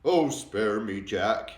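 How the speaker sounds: loud begging cry; a giant begging Jack to spare him; pleading yell. A narrator's reading. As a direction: yell for mercy. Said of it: "he yelled".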